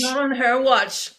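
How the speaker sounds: slow, high, loud cheering echo. Real, not acted. Friend echoing the host's vow, slow loud cheer. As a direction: back her up with a slow cheer. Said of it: "happening under my watch".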